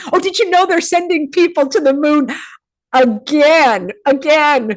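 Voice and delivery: high, loud, still tickled; incredulous and giggly; amused disbelief. Real, not acted. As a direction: exclaim it through leftover laughter. Still laughing, mocking moon news.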